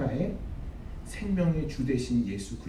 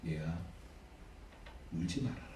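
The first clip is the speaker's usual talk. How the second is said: much lower and softer, gently soothing; tender comfort; 10 semitones lower, 12 dB quieter than usual. Acted. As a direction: comfort a grieving mother softly. Jesus comforts the weeping widow. (낮고 부드럽게 위로하듯)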